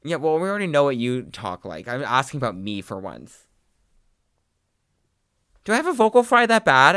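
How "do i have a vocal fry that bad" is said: louder, higher shocked question. Real, not acted. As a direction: ask in disbelief.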